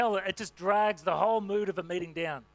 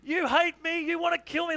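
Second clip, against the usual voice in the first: put-on much higher and louder childish wail; mock tantrum; 9 semitones higher, 4 dB louder than usual. Daughter Gracie raging at her dad, high shrill tantrum wail. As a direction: act out a furious little girl. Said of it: "goes absolutely ballistic".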